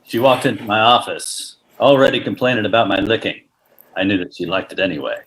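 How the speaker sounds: noir detective. Acted. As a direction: brood like a hardboiled detective. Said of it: "as a film noir".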